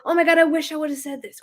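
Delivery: high, rueful cry; regretful client. Retold or imitated. Client on a coaching call, high and regretful. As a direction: moan over a missed chance.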